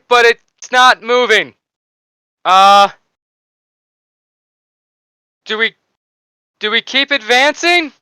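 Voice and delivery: high, loud, hesitant radio report; soldier over comms. Acted. Soldier reporting the crater to the squad over comms, high and hesitant. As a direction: pitch it high, uncertain field report. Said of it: "you hear, uh, over your comms".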